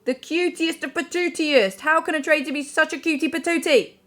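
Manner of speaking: high, loud cutesy gushing. Real, not acted. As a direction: coo it out adoringly. Gushing about Mina.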